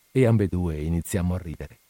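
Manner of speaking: ordinary delivery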